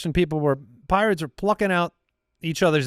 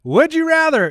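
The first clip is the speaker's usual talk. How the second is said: ordinary talk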